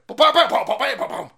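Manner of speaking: high, loud bam-bam burst falling off; punchy vocal sound effect, dropping in pitch; mock fanfare noise. Capping an insult to the offended, loud vocal bams.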